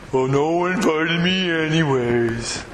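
slow, mopey Eeyore voice; gloomy Eeyore. Retold or imitated. Preacher voicing Eeyore for the congregation, slow and mopey. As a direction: drag it out like sad Eeyore. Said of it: "Don't be an Eeyore".

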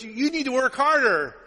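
high, loud imitation of a scolding mom; mock scolding. Mom lecturing son over his grades, mock scolding. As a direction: ream the kid out as mom. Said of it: "she kinda reamed me out".

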